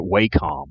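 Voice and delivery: "Wacom" said as WAY-com; alternative pronunciation. Shown as a demonstration. Co-host giving his own pronunciation, "Wacom" as WAY-com. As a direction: say "Wacom" with a long ay, like "way". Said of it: "I always pronounced it Wacom".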